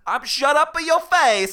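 loud, high mock Italian accent; Australian attempt slipping into cartoon Italian; fake Italian accent. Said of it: "that was a perfect Italian".

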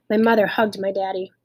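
usual speaking voice

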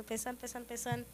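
very soft, repetitive thinking to oneself; murmured musing. Retold or imitated. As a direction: let the words drop right down, like circling thoughts. Acts out thoughts circling in her head.

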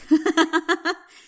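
a loud, high real laugh; burst of laughter. Real, not acted. Laughing after warning client of blunt news, loud real laugh. Laughs at own bold line.

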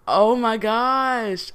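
long, high self-reproaching exclamation; exasperated at herself. Real, not acted. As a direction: wail it slowly, mortified at blanking. Blanking on a guest's name.

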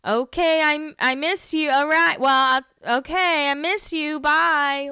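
high, halting, nervous phone message; mock flustered voicemail; awkward voicemail voice. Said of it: "She's just like".

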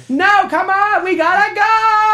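very high mock yelling; shrieky, insistent put-on cartoon voice; bad Woody voiceover. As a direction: nag like a hammed-up cartoon cowboy. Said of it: "screaming at me in a really bad Woody voiceover".

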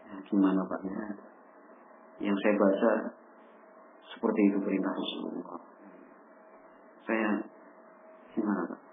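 soft, humble answer with bowed head; a son answering his father humbly, soft and bowed; polite, head bowed. Acted. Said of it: "bahasanya yang santun, sambil tertunduk".

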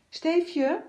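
high, sugary sing-song "Steefje?"; sweet girl's call. A narrator's reading. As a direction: coax the boy sweetly. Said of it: "zo schattig doen". (een lief, hoog gerekt "Steefje?")

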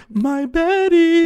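overacted soap opera cry; mock Alice wail. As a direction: stretch it out like a melodramatic mother. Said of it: "she's like".

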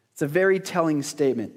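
ordinary talk, nothing marked

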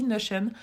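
"Notion" with English NO-shun sounds; American pronunciation. Shown as a demonstration. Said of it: "je le prononce plus à l'américaine". (« Notion » à l'américaine, « nocheune »)

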